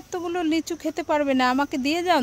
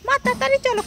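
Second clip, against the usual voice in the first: put-on high, impatient child; 9 semitones higher, faster than usual. Child hurrying mother along, high child voice.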